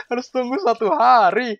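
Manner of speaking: high, loud, drawn-out lament; slow, high wail of protest; incredulous complaint. Real, not acted. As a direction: complain loudly, stretching it out in disbelief. Laments the day's wait again.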